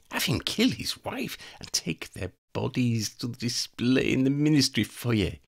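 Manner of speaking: high, cold voice of a female vampire plotting murder; scheming vampire woman. Acted. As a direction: savour each threat like a predator. Elena plots.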